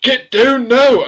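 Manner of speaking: high, loud Scottish police yell; loud mock Scottish shout; Scottish accent shout. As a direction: bark it out like a Scottish cop. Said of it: "That's my best Scottish accent".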